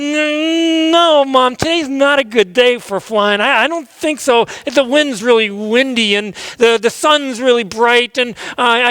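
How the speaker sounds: high nervous stammering pleading; 1 scared eaglet. Acted. Young eagle afraid to fly begs mom, nervous high voice. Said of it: "And he said".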